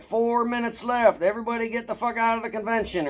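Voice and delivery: hammed-up closing announcement; mock intercom announcer. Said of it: "they're on the intercom".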